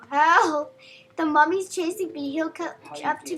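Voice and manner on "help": yelling for help. Real, not acted. Chased by the mummy in game.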